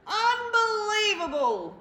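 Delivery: loud, high, slowed exclamation; rumbling outburst. Acted. Bear finds the mouse again and bursts out loudly. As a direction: stretch it out in outraged disbelief. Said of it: "rumbled Bear".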